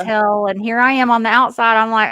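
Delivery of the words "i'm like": ordinary talk, nothing marked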